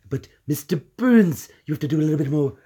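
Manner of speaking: usual speaking voice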